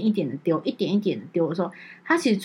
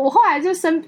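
ordinary talk, nothing marked

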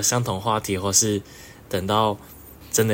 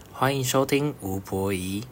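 ordinary talk, nothing marked

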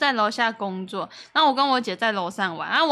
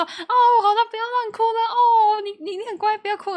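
higher coaxing big-sister voice, softening at the end; sister soothing; 10 semitones higher than usual. Retold or imitated. Big sister coaxing crying little sister, mock soothing voice. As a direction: hush a crying little sibling, half-mocking. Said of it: "他就说".

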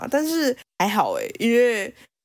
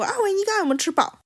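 quick, much higher mock mom voice; fussing mom; 11 semitones higher, faster than usual. Mom asks whether the boyfriend ate enough, in a quick, high mock mom voice. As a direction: fire it off fast, like a fussing mother. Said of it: "然后我妈就会说".

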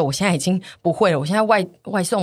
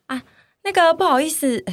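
giggly voice; 9 semitones higher than usual. Shown as a demonstration. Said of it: "那请省用这个语气讲说".

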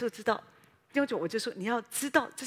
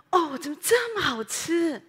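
higher, louder, slow exclamation of delight; 6 semitones higher, 7 dB louder, slower than usual. Shown as a demonstration. Preacher showing joy at tasty food, slow loud delight. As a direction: savour the taste out loud. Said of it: "你吃到一个好吃的食物".

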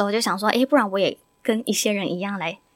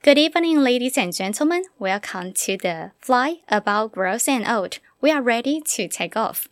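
English in-flight announcement; smooth cabin crew welcome, slowing; flight attendant. Pretend.

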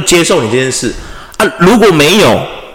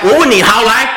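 high, heated betting dare, slowing at the end; angry challenge; 10 semitones higher than usual. Retold or imitated. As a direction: dare him hard, half laughing. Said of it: "我就真的发飙".

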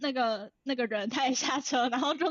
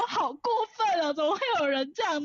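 a hint of an indignant mom, pitched up; imitating mom; 6 semitones higher, 4 dB louder than usual. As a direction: mimic mom's outrage, higher and a bit louder. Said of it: "那我妈就说". (模仿妈妈愤愤不平，声音更高)

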